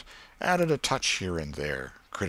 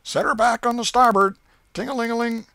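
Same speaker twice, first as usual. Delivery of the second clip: put-on high, loud boyish shout of commands; steamboat captain shouting orders; 9 dB louder than usual. A narrator's reading. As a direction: bark the orders like a riverboat captain. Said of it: "giving the orders and executing them".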